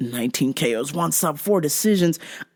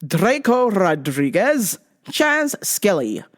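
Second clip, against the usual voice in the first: normal talking voice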